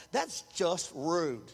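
low, slow, put-on offended voice; a lightly voiced offended believer; mock offended. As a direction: play the hurt churchgoer, drawn out.

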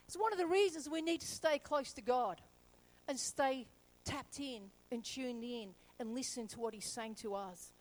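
ordinary delivery

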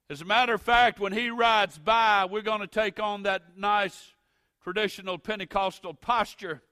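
loud, high, building preacher's cadence; preaching at full force. Acted.

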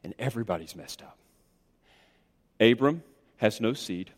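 very low, hushed, spaced-out summing up; somber preaching. Acted. Preacher tallying the ruin of everyone involved, somber. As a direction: let each loss drop quietly.

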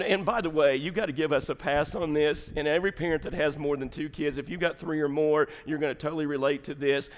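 usual speaking voice

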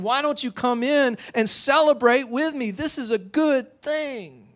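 high, swelling joyful plea; impassioned father. Acted. Father urging older son to celebrate, impassioned. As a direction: build to an urgent celebration. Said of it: "the father looks at him, he says".

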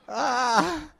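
high, loud scream of being caught; startled scream. Retold or imitated. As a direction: yelp like caught kids. Caught hiding in closet.

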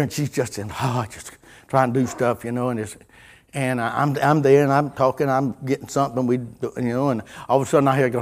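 ordinary talk

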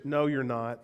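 flat denial. Retold or imitated. Locals doubting his answer.

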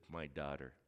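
soft, low and gentle; tender call. Acted. Jesus to the kneeling woman, softly tender. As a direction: call her like a loving father.

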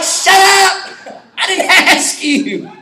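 play-yell at Siri while cracking up; laughing mock shout. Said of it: "I was like".

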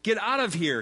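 loud, emphatic reading of an angry order; narrated snarl. A narrator's reading. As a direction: read it as a mild snarl. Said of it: "he snarled at her".

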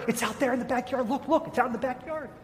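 mother gently steering the kids to the window, mildly voiced; lightly voiced mother coaxing. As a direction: lightly coax the kids to look.

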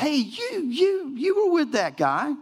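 put-on very high, slow accusing girl; accusing servant girl. Servant girl spots Peter again, high and slow. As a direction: jab the finger at him. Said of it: "and says".

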